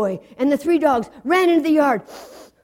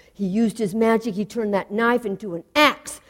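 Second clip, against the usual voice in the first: ordinary delivery, nothing marked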